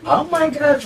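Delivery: oh my gosh flung up high; delighted squeal of surprise; excited gasp. Real, not acted. Guest hearing there is brunch, delighted high exclaim.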